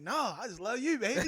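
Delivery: sweet-talking through a laugh. Retold or imitated. Husband dodging his wife's question, laughing sweet talk. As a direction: charm her to dodge the question.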